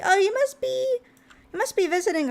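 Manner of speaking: jumps high on Oh, then eases back down; a bright, high mock-cheerful Oh you must be; excited guess. Excited guess about friend's visit.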